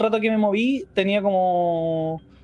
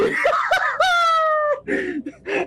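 long, very high squeal of laughter; high shrieking laugh. Real, not acted. Startled by the creature overhead.

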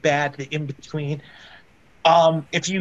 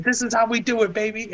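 higher, pumped-up ring announcement; hyped wrestler call; 7 semitones higher than usual. Retold or imitated. Heath Slater hyping the crowd on the commentary mic, pumped-up higher call. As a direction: hype the crowd like a heel on the mic.

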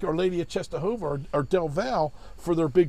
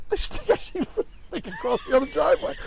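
high-pitched impression of a simple big guy; mock simpleton voice, high; 10 semitones higher than usual. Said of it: "who is a little slow".

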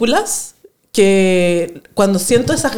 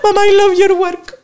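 gushing laugh; 8 semitones higher than usual. Acted. Emotional thanks to mom.

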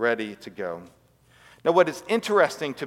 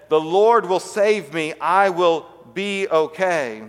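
slow, drawn-out, louder refusal; emphatic calling up; 3 semitones higher, 4 dB louder, slower than usual. Retold or imitated. Stranded man answers the helicopter above, slow emphatic call. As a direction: stretch each word, calling it upward.

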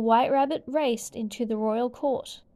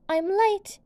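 much higher, louder panicked cry; a rabbit squeaking in a rush; cried out; 10 semitones higher, 4 dB louder than usual. A narrator's reading. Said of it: "he cried".

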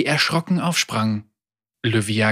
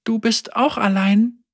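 put-on young girl voice; 9 semitones higher than usual. A narrator's reading. Girl's tender question.

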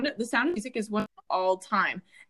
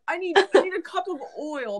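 higher mocking dad voice; 8 semitones higher than usual. Daughter mocking dad asking for oil while cooking. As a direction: parody a helpless dad. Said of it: "he's like".

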